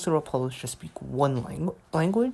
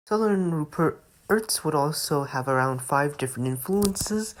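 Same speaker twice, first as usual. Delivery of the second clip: ordinary talk, nothing marked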